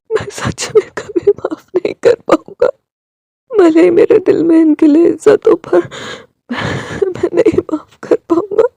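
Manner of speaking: weep it out, trailing off; broken tearful murmur; quiet crying. Acted. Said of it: "वो रोने लगा था".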